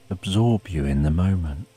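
ordinary delivery, nothing marked

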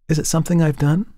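put-on a touch of a worried young woman; Tessie, young model. A narrator's reading.

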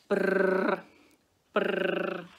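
loud rolled brrr; drill noise. A narrator's reading. Baby wolf drills the hive, motor noise. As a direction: buzz like a toy drill boring in.

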